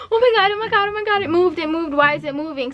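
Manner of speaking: high, rushed retold freak-out; scared panic. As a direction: reenact panicking at sudden news. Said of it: "I'm scared 'cause I'm like".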